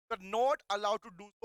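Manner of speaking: slow, deliberate reading of the law text; slow dictation. Shown as a demonstration. Teacher dictating slowly to students. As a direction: dictate each word slowly to the class.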